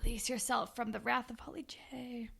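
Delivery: breathy whispered incantation; mock-solemn whisper. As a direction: whisper it like a mystical command. Mock-solemn whisper about symbolism.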